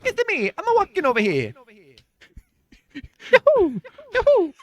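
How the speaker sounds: Mario voice. Pretend. Mario announcing himself with high yahoos. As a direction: bounce it like Mario.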